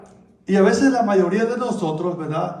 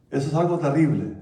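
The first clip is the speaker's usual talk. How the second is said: usual speaking voice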